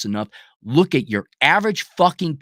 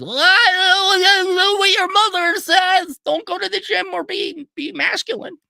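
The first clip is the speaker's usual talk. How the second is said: whiny falsetto father, much higher; mock a limp-wristed dad in a high whine; slower than usual. Mocking fathers' advice.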